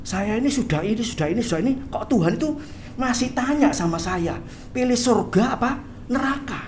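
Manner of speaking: high-pitched, baffled protest; startled. Acted. Church worker startled by God's question, acted bewilderment. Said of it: "Orang ini kaget".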